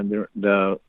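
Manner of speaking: usual speaking voice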